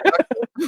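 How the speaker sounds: a sudden high, loud laugh that breaks out; burst of high loud laughter. Real, not acted. Woman laughing loudly at a far-fetched point.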